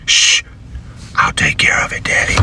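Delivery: imitated child's conspiratorial whisper; menacing whisper. Daughter promising dad she'll handle it, soft whisper. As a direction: hush him and whisper it.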